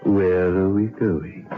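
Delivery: ordinary delivery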